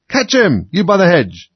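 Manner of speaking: high, urgent yell; shouted order. Acted. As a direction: shout across a garden to bystanders. Rabbit shouting to onlookers.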